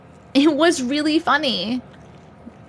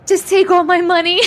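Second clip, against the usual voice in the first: mock swoon; 7 semitones higher, 4 dB louder than usual. Real, not acted. Joking about merch.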